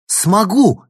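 high, loud outburst; defiant shout. A narrator's reading. Girl told she can prove nothing, shouts back. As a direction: cry it out defiantly. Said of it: "крикнула она".

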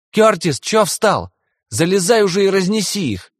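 high-pitched yell from below. Acted. Boy yelling up from below.

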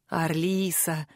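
low, slow satisfied exhale of a pirate captain; low, drawn-out satisfied sigh of a name. A narrator's reading. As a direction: breathe her name out low and slow, savouring it. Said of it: "удовлетворённо выдохнул он".

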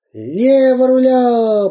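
высокий протяжный радостный крик; повтори с детским восторгом; восторженные мальчишки. Acted. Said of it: "повторили восторженные мальчишки". (мальчишки в восторге повторяют за пиратом)

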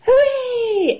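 very high, drawn-out cheer. A narrator's reading. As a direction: squeal a joyful hooray for a whole crowd. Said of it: "everyone cheers".

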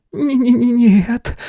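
high, slow, stammering from the cold; shivering stutter; teeth chattering. Acted. Frozen girl answers shivering.

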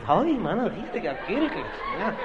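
high, squeaky gossip voice; gossiping wife on the phone, squeaky high voice; mock gossiping housewife. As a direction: ham up a chattering neighbor lady.

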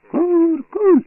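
very high, loud piercing chant; shrill highest voices. A narrator's reading. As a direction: cut through with the top of the voice. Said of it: "різнуло найвищими голосами".

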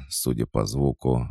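plain reading voice

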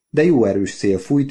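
ordinary delivery

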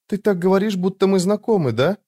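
put-on high-pitched young woman's voice; puzzled girl, pitch held high; girl character's voice. A narrator's reading. Narrator voices the bewildered girl.

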